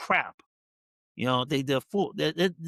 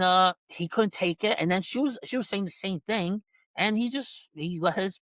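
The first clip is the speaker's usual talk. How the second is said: ordinary talk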